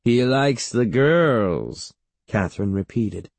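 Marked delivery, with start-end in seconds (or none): 0.0-1.9 very slow, drawn-out tease; leering. A narrator's reading. Great-aunt looming close to the boy, leering. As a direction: savour each word with a leer. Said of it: "leering at him".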